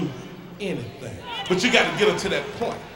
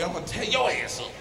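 woman answering back; 3 semitones lower, 8 dB quieter than usual. Retold or imitated. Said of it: "she look at me and say".

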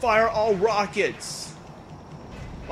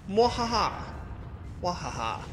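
hammy villain cackle fading out; mad scientist laugh; 7 dB quieter than usual. Pretend. Evil laugh after freezing enemy.

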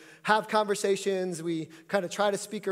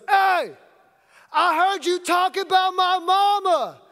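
mock yell, much higher and louder; yelled threat; 10 semitones higher, 6 dB louder, slower than usual. Stranger yelling at the coach across the court, loud threat. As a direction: call out across the court like a tough guy. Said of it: "I hear this voice yell out".

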